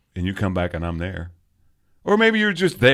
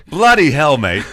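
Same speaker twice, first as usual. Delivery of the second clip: laughing, hammed-up fake British accent, louder; 7 semitones higher, 7 dB louder than usual. Host teasing co-host after beers, in a mock British accent. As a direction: toss off a jokey British "bloody hell, mate". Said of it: "my fake British accent".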